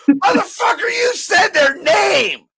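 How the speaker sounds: high, loud, stretched-out protest; shrieked comic outrage; outraged shout. Real, not acted. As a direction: yell the accusation, dragging out the last word. Mock outrage at the other's remark.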